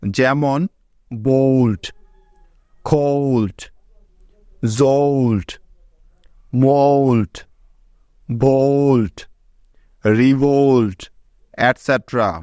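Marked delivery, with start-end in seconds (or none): 1.1-11.0 বোল্ট, কোল্ট, জোল্ট read one by one, with ও gliding to ঔ before lt; example words with ou. Shown as a demonstration. Teacher reads the lt example words aloud for the class with ঔ. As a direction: set each word apart and make the ঔকার glide clear. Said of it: "এলটি এর কিছু উদাহরণ".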